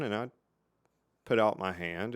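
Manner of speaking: normal talking voice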